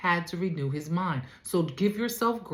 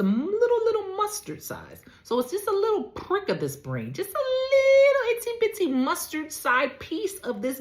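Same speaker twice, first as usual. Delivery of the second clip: high squeaky itsy bitsy voice; tiny little voice; 12 semitones higher than usual. Acted. Preacher stresses how small the mustard seed is in a high tiny voice. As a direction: pitch up to make it sound tiny.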